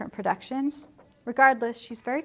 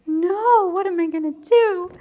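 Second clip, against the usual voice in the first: high, loud cry of dismay; mock panic; 8 semitones higher, 7 dB louder than usual. Traveler told he faces death in Padua, mock panic. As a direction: wail it in exaggerated alarm.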